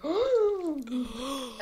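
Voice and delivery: high, drawn-out astonished oh; hammed-up high oh; shocked reaction. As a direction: act out a big gasp of shock. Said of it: "That was our reaction".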